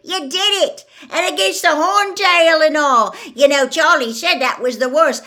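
loud, high, elated hoarse voice; hoarse, excited congratulations at full volume. A narrator's reading. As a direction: cheer through a rough throat. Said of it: "said Hagrid hoarsely".

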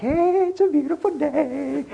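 high, sing-song happy greeting; mock carefree cheer. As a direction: chirp it high like someone without a care. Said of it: "you're not like".

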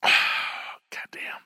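whispered sigh of relief; satisfied whisper. Real, not acted. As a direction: breathe it out in a whisper after a sip. After sip of beer.

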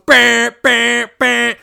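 high loud imitated alarm blare; mock alarm sound, high and loud; alarm noise. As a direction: blast it like a phone alarm.

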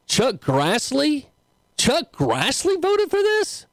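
can't believe the name on the list; dragged-out high-pitched disbelief; stunned disbelief. Real, not acted. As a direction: pitch jumps far up, slow and incredulous. Shocked reading of the vote list.

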